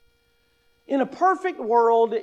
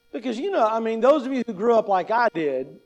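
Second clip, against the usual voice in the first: ordinary talk, nothing marked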